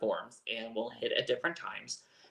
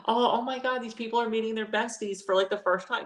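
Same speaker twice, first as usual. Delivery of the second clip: gushing over puppies; 10 semitones higher than usual. Retold or imitated. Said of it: "I was watching and I'm just like".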